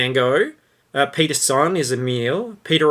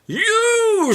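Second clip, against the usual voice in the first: high furious shout; angry shout. Acted. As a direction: yell at him, outraged. Skinner yelling.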